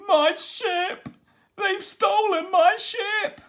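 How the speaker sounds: high, loud, hammed-up pirate wail; a pirate captain sobbing over his stolen ship. A narrator's reading. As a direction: wail it like a heartbroken old sea dog. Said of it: "he sobbed".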